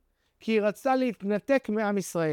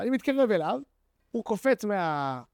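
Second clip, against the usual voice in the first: normal talking voice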